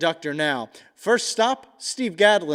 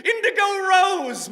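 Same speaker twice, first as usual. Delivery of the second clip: excited shout; 10 semitones higher, 5 dB louder than usual. Acted. Excited burst in list.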